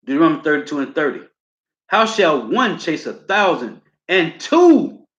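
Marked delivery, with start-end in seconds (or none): 1.9-5.1 very slow, higher proclamation; slow, lifted scripture reading; preaching scripture. Acted. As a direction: stretch every word like a preacher. Preacher reads scripture aloud.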